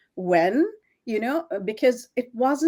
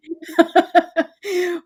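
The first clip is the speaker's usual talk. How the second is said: laughing out loud, pitched up; a bright spontaneous laugh; a real laugh breaks through; real burst of laughter; 7 semitones higher, 6 dB louder than usual. Real, not acted. Laughs mid-quote of husband.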